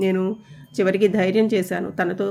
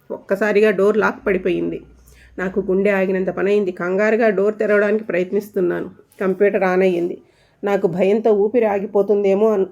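plain reading voice

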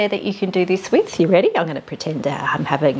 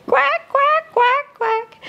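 very high squeaky duck quacks; much slower than usual. Acted. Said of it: "Mother Duck said".

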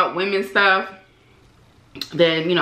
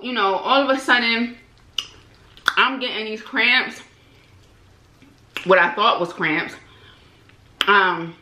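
speaking between bites; talking while chewing. Real, not acted. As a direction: mouth partly full of food. Seen 5: holds chicken, bites repeatedly at mouth while talking throughout.

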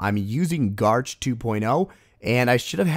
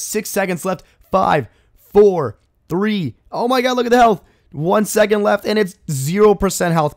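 climbing higher and louder each second; tense countdown; 7 semitones higher, 6 dB louder than usual. Real, not acted. Gamer counting down the raid's final seconds, rising excitement. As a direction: call the last seconds like a match ending.

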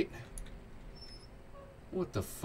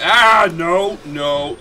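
loud startled no; startled cry; 7 semitones higher, 13 dB louder, much slower than usual. Real, not acted. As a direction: cry out in alarm. Startled by blood.

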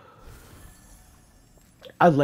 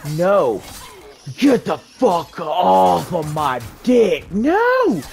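angry yelling; 5 semitones higher, 5 dB louder, slower than usual. Real, not acted. Enemy grabbing him.

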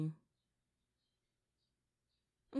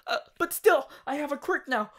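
higher, louder gleeful rush; excited; 8 semitones higher, 5 dB louder than usual. Acted. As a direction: bubble over with joy. Thrilled about having a quirk.

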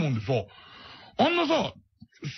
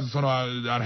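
ordinary talk

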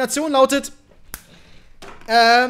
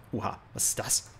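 ordinary talk

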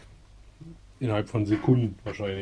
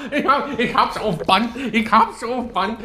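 laughing; 10 semitones higher, 4 dB louder, slower than usual. Real, not acted. Laughing while filming tantrum.

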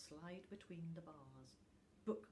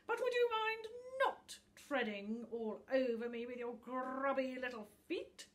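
put-on higher, louder peevish old book; rusty, dusty voice; 7 semitones higher, 11 dB louder than usual. A narrator's reading. Book scolds little Tuft for treading on him, peevish. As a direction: grumble up at the clumsy boy. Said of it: "said a rusty, dusty voice".

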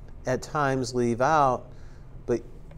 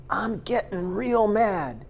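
higher, slow mock anger, dropping the g; mock mad voice; 9 semitones higher, slower than usual. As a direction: ham up a folksy angry drawl. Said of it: "they'll get into voice".

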